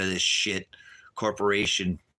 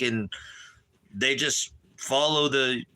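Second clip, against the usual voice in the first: ordinary delivery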